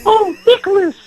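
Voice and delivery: put-on very high, fawning old lady voice; hammed-up adoring grandma; gushing grandma. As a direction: swoon over his strength in a squeaky granny voice. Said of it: "He'll be like".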